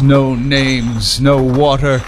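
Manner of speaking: ordinary talk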